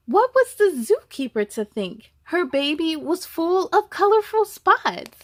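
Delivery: put-on reading to children. A narrator's reading. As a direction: tell it brightly to the little ones. Reads the picture book aloud.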